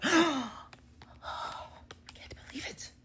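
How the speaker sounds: high gasp then a whispered disbelief; stunned guests gasping; shocked gasp. Retold or imitated. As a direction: gasp and breathe the words in shock. Crowd shocked by objection.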